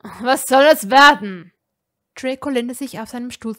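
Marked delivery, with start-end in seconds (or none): 0.0-1.5 much louder, shouting angrily; furious shout. A narrator's reading. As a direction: bellow it across the room. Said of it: "Rons Stimme war laut".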